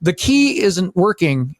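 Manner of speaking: retold insistence, word by word; deliberate complaint. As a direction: spell it out slowly for the desk staff. Retold complaint to staff, slowed.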